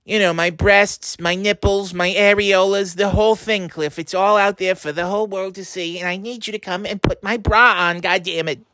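put-on high old grandma pleading for help; whiny elderly grandmother calling her grandson. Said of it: "these fucking voices".

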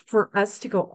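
ordinary talk, nothing marked

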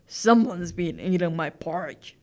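low, firm storybook bear voice; growling papa bear. A narrator's reading. Papa bear finding his porridge eaten, growling low. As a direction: growl it deep as the big bear. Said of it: "growled the papa bear".

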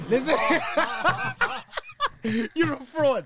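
high laughing tease. Real, not acted. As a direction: laugh through the insult. Laughing teasing.

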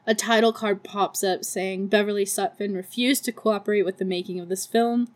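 normal talking voice

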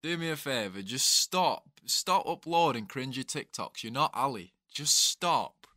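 loud, high-pitched exasperated rant; worked-up mock appeal, punching it out; exasperated plea. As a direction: plead with the lads to stop. Mock appeal to cringy TikTokers.

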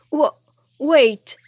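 broken, high, loud plea; stutter, then cry out high; stammering. A narrator's reading. King cornered by his own soldiers' clubs, stammering. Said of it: "he stammered".

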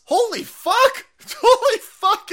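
high, loud, slow amazed yell; astonished outburst. Real, not acted. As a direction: yell it out in disbelief.

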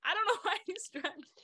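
high, laughing protest; can't keep a straight face while explaining; laughing excuse. Retold or imitated. Recruit getting yelled at over drenched partner, laughing. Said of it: "I'm like".

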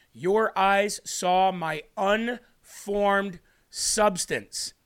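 Your drawn out slowly, each word weighted; very slow, stressed YOUR. Shown as a demonstration. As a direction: dwell on every word, leaning on Your. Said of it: "Let me repeat that one more time".

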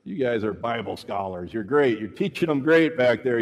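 ordinary talk

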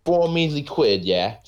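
high, slow hammed-up Cockney; mock British accent. Friend joining the British joke, slow mock Cockney. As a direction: sneer it like a British geezer.

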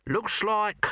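high nasal little corporal; squeaky weaselly watchman voice; Nobby voice. A narrator's reading. Nobby character voice.

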